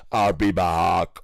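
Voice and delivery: slow, low Austrian movie-robot voice; Arnold accent. Retold or imitated. Streamer shows chat his Arnold accent. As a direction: lay on a thick Austrian accent, slowly. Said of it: "perfect Arnold accent".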